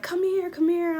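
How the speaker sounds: high, loud imitation of mom; urgent retold summons; mom calling over. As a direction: imitate a parent beckoning. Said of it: "my mom was like".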